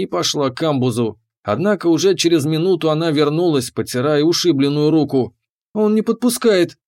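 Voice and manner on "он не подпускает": higher, indignant complaint. A narrator's reading. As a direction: protest it with outrage. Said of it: "возмущённо".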